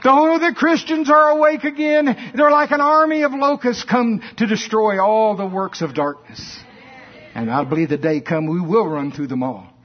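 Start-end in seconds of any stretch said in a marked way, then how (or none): 0.0-6.6 terrified devil shriek. Retold or imitated. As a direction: cry out like a devil facing locusts. Devils shout in dread.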